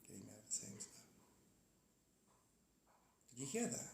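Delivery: very soft unvoiced whisper. Real, not acted. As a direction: breathe it out, barely audible. Whispering while distracted.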